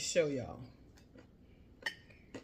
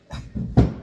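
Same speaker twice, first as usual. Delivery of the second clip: big unvoiced laugh; loud laugh; 14 dB louder than usual. Real, not acted. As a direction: burst of breathy laughter. Laughs after tasting chicken.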